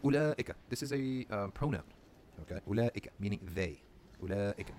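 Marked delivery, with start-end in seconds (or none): none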